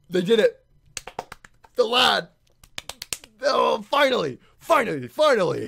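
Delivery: loud, high celebration; loud triumphant finally; excited cheer. Real, not acted. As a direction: cheer him on at last. Cheering a character's moment.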